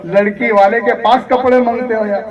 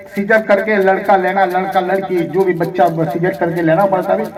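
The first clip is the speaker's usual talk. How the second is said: usual speaking voice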